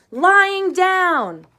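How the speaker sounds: very high, loud shriek; the other side shrieking back in the quarrel. A narrator's reading. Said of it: "The other side shrieked".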